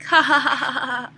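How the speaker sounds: loud, hollow ha-ha-ha; mock laughter at her own humiliation; forced fake laugh. Fake laugh after sister mocks.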